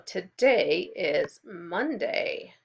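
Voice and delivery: slow, clear teacher model; slow model sentence. Shown as a demonstration. Teacher models the date for the class, slowly. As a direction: say it slowly for them to copy. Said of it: "Can you repeat after me?".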